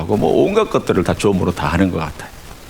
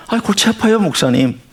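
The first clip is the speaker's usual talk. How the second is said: mock-complaining churchgoer, pitched much higher; playfully whiny complaint to the pastor; weary congregant; 13 semitones higher than usual. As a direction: groan it like a bored listener.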